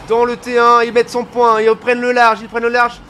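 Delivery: loud shouting play-by-play; esports caster. Acted. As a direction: belt out the call. Team pulls ahead, caster shouts.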